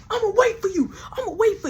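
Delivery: fired man threatening on his way out; mock threat. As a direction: warn him you'll be waiting.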